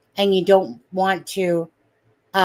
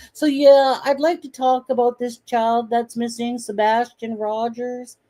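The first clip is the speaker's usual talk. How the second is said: higher, put-on voice, like someone else calling in; mock disguised caller voice, pitched up; 3 semitones higher than usual. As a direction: fake a stranger's voice to disguise yourself. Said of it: "he literally is voicing like that".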